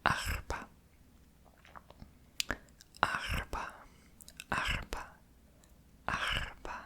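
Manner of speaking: "Arpa" whispered slowly, lingering on each sound; savouring a word. Shown as a demonstration. Savouring the Arabic word for viewers, whispered. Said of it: "I love that".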